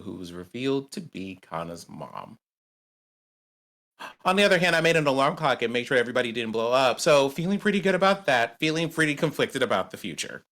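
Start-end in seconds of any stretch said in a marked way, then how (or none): 4.2-10.4 excited. Acted. Player celebrating the in-game rescue.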